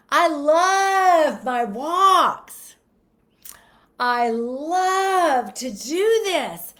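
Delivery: high, loud, very slow and drawn out; joyful. Shown as a demonstration. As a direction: savour each word with delight. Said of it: "what if it instead it was".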